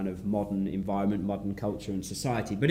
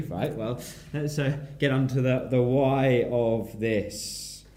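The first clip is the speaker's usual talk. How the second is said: ordinary delivery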